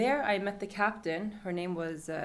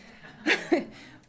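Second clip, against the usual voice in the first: high airy giggle; breathless high giggle; high laugh; 10 semitones higher, 4 dB louder than usual. Real, not acted. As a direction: laugh at how simple it was. Laugh at WhatsApp.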